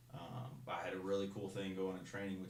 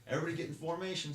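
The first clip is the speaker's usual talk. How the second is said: higher, louder, fast drill instructor order; barked order; 9 semitones higher, 3 dB louder, faster than usual. Retold or imitated. Drill instructor ordering everyone into formation, barked. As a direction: snap the order at the barracks. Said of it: "He was like".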